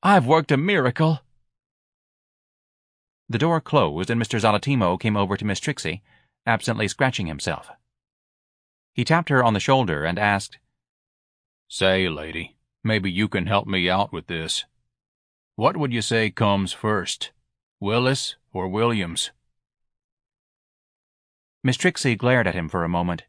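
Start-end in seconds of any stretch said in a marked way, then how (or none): 0.0-1.2 high, triumphant wife; self-congratulating society lady; proud. A narrator's reading. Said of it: "Missus Levy said proudly". 11.7-19.3 lightly voiced laid-back office worker; dim, casual clerk. Clerk asks old woman for help.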